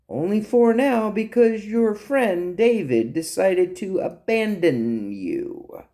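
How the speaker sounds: high, drawn-out theatrical speech; hammy, swooping villain monologue; taunting captive monologuist. Acted. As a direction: lecture your captors with slow, mocking relish. Ralph's monologue, acted.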